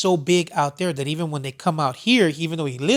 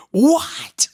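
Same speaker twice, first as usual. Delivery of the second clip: put-on shocked little kid; 11 semitones higher, 5 dB louder than usual. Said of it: "He started crying".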